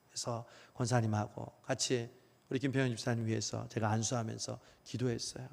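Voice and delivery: ordinary talk, nothing marked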